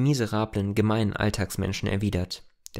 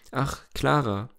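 a touch higher, sighing Ach, Clara with falling tune; despairing sigh; 3 semitones higher, slower than usual. A narrator's reading. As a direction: lament her name, mildly dissolved. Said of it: "seufzte wie aufgelöst in trostlosem Jammer". (leicht seufzendes, klagendes Ach, Clara)

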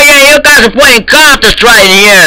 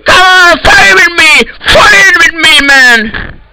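furious shout; 5 semitones higher than usual. Acted. Server admin challenging intruders, crying furious shout. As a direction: scream the challenge, voice breaking.

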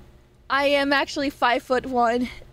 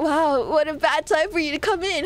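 normal talking voice

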